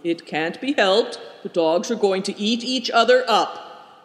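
ordinary delivery, nothing marked

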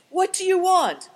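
high, loud frightened question; alarmed demand. A narrator's reading. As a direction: ask in panic at gunpoint. Man at gunpoint pleads.

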